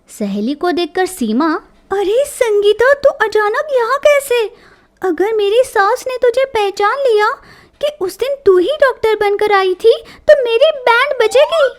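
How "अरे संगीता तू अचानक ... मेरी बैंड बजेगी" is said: higher-pitched, worried surprise; startled daughter-in-law. A narrator's reading. Seema seeing her friend arrive suddenly, startled. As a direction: greet your accomplice in startled worry.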